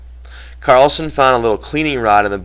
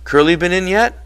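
lightly tossed-off question, higher pitched; casual; 9 semitones higher than usual. A narrator's reading. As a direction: ask it offhand, just checking. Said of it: "Carlson said casually".

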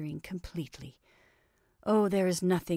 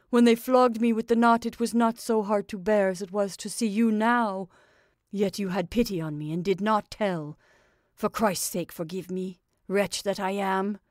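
pleading; 2 semitones higher, 6 dB louder than usual. A narrator's reading. Makar confesses, about to sob.

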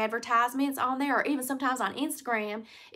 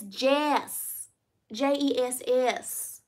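slow correction; much slower than usual. Pretend. Correcting her own name.

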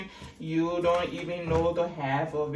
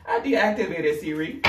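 ordinary talk, nothing marked

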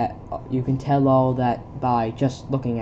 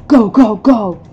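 higher, loud go go; urging shout; 6 semitones higher, 12 dB louder, slower than usual. Acted. Soldier urging the squad forward, loud shout. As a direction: hurry the squad.

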